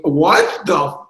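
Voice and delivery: loud, drawn-out retold disbelief; shocked reaction. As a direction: blurt it out slowly, as if stunned, cutting off the swear. Acted shocked reaction to diagnosis.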